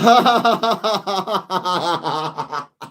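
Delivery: long burst of laughing; real laughter. Real, not acted. Streamer reading a chat question, cracking up laughing.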